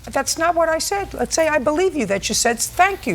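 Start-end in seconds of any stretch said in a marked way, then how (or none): 2.6-3.1 a higher, sarcastic "thank you"; mock the snide tone of "thank you"; sneering thanks with a pitch jump. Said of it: "You said it in a snide way".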